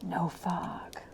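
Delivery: breathless whispered wonder; hushed whisper. A narrator's reading. Narrator gazing from the window, whispered awe. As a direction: whisper it as if afraid to break the spell.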